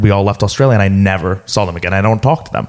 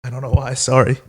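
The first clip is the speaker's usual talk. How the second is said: tearing up. Real, not acted. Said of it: "I'm getting emotional".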